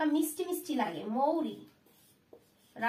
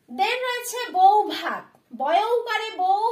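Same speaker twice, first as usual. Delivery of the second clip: louder and slow, stressing বউ; teacher's classroom announcement; announcing loud; 4 semitones higher, 6 dB louder, slower than usual. Shown as a demonstration. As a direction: call out the new word বউ ভাত for the class. Teacher announcing a new word.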